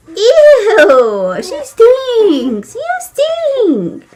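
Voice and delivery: high, loud, laughing mock disgust; high playful squeal over a dirty diaper. Real, not acted. Baby's diaper smells.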